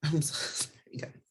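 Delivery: real tearful, whispered apology; choked up. Real, not acted. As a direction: voice gives out, barely a whisper. Breaks down telling abuse story.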